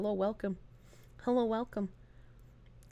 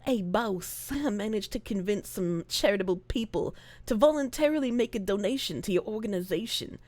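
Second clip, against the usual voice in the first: mafioso; 5 semitones lower, 7 dB quieter than usual. Acted. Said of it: "Mafioso orphan".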